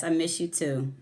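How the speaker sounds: ordinary talk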